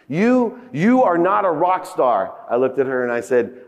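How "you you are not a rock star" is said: retold scream. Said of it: "she screamed at me".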